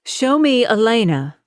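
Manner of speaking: put-on mother figure shouting to the house for the child. A narrator's reading. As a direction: shout it across the yard. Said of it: "Melina shouted".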